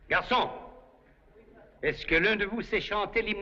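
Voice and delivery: calling out. Real, not acted. Calling a waiter.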